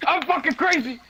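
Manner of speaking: high, gleeful declaration; excited yell. Real, not acted. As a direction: shout it with glee. Proud reply to being called crazy.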